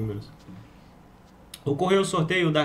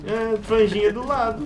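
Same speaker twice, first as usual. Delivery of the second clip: teasing; 8 semitones higher, 6 dB louder, slower than usual. Real, not acted. Teasing, loud drawn out.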